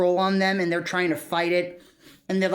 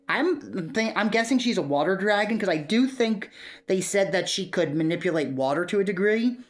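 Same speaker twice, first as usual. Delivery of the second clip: normal talking voice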